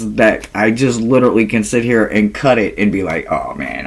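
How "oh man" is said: deflated mutter. Retold or imitated. Mock reaction to card.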